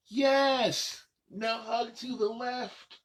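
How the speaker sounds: ordinary talk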